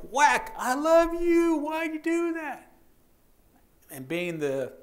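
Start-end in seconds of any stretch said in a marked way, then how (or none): 0.1-2.7 very high, slow wailing woman's voice; crying woman. Retold or imitated. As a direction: plead through tears while hitting. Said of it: "she goes".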